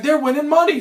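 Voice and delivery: excited realisation. Retold or imitated. Excited echo of the winners.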